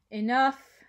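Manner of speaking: loud curt command; one word to end it; stern. A narrator's reading. Father silencing the table, loud and curt.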